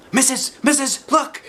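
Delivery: high, loud eager calling; thrilled girl calling teacher; excited child. A narrator's reading. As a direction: call out, bursting to show.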